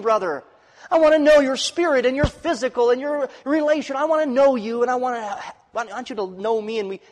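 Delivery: full-throated preacher pleading for real affection; fervent preaching. Acted. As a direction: press the point home to the congregation.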